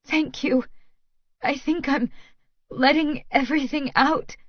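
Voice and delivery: high, tearful voice; through tears. A narrator's reading. Woman thanking lover after crying, high and tearful. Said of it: "I say through my tears".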